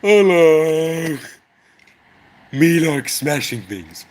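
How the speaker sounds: lightly voiced brute; dumb buddy voice. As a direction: play the dim-witted friend. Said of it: "my buddy Dan".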